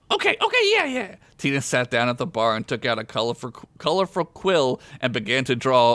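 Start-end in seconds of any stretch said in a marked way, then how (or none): 0.1-1.2 put-on very high child voice; eager little girl. A narrator's reading. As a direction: agree eagerly like a small girl.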